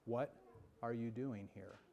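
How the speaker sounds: soft, low still small voice; quiet questioning voice of God. Acted. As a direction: hush it like a gentle inner voice. Preacher voicing God's quiet question.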